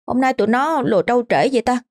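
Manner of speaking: lightly voiced, wondering boy; puzzled boy. A narrator's reading. Boy alone wonders about friends.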